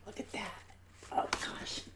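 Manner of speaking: hushed, breathy catch of emotion; touched, barely voiced; choked-up whisper. Real, not acted. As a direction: whisper it, moved to tears. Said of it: "I still get choked up".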